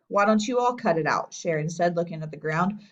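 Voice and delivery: ordinary delivery, nothing marked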